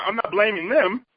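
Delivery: normal talking voice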